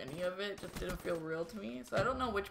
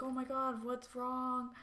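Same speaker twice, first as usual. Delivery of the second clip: higher-pitched mild mock alarm; imitated alarmed boyfriend; 6 semitones higher, 3 dB quieter, slower than usual. Boyfriend running in on the screaming, mildly alarmed imitation. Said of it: "he fucking comes running".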